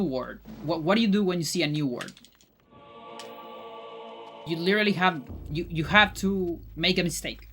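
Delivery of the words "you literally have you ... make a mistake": ordinary talk, nothing marked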